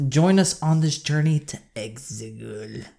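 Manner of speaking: slow, growly Palpatine voice; Palpatine impression. Inviting the listeners to Exegol, slow and gravelly. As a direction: drag it out like a croaking Palpatine. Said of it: "like an angry French man".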